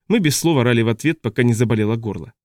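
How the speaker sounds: ordinary delivery, nothing marked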